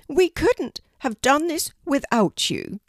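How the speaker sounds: high, emphatic bird voice; grateful raven. Acted. Harris thanks Kelty for saving Poppy, high grateful voice.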